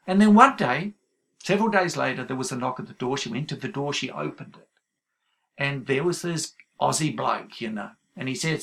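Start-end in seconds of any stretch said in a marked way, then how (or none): none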